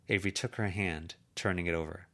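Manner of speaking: plain reading voice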